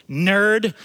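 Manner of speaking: loud parting jab; imitated contemptuous driver; dismissive insult. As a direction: toss it out as a scornful label. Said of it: "she shakes her head, and she goes".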